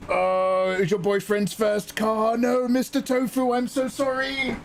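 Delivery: high, slowing pitying cry; sympathetic wail. Real, not acted. Streamer apologizing to Mister Tofu, high pitying whine. Learns it was boyfriend's car.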